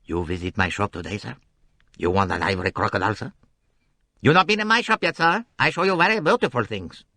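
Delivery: put-on smooth persuasive seller with a light accent; suave shopkeeper. A narrator's reading. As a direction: coax the tourist into the shop. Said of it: "Suave, persuasive accents here".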